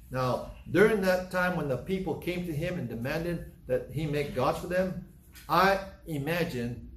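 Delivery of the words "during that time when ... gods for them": normal talking voice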